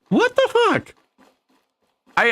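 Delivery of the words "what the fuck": very high-pitched, baffled outburst. Retold or imitated. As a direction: squeak it out in exaggerated disbelief. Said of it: "I was left baffled and confused".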